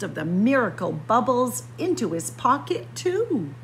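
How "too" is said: usual speaking voice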